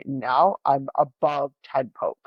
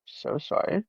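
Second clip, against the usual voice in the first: apologetic; 14 semitones lower, slower than usual. Retold or imitated. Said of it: "And she's like".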